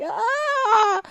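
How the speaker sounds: high-pitched drawn-out scream; comic cry of panic; mock inner scream. Acting out her inner scream.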